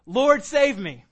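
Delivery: loud, slow cry for help; mock scream. Peter sinking in the waves cries to Jesus, loud slow plea. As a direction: call out like a man going under. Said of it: "Peter just screamed".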